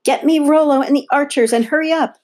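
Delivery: high, loud shouted order; urgent yell. A narrator's reading. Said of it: "was Olympia yelling".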